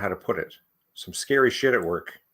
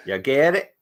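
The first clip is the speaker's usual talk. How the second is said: a touch higher, a light new take on the waitress; redone waitress voice; 3 semitones higher, 4 dB louder than usual. Acted. Corrected by his co-reader, he re-voices the waitress in a slightly higher voice. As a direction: try the waitress line again in a different voice. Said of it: "I don't think that's how she sounds".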